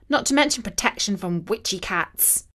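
ordinary delivery, nothing marked

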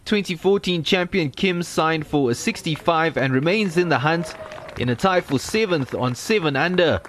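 ordinary delivery

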